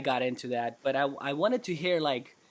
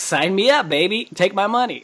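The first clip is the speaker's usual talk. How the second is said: loud and high, playfully sold on it; mock buyer cheering, grabbing his wallet; eager mock sales pitch; 6 semitones higher, 8 dB louder than usual. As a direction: shout it like a thrilled customer. Gleeful sales pitch punchline.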